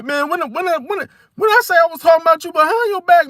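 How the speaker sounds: stammered mock denial, pitched high; defensive protest. Acting out a Virgo accused of backbiting, defensive. Acted comeback in a confrontation.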